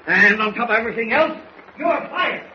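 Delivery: angry firing, voice shooting up; bad-tempered outburst. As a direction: let the temper climb to a high finish. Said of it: "has a bit of a temper".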